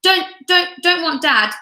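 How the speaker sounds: put-on a toddler bellowing refusal. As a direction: bawl it like a cross baby. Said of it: "bellowed the baby".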